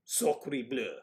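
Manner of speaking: light put-on French accent; mock French accent. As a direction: play a cartoon Frenchman. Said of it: "French is a lovely accent".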